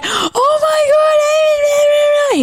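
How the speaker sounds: high, drawn-out sobbing shout; overwhelmed kid crying out loud; mock crying wail. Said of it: "I've thrown myself to the ground crying".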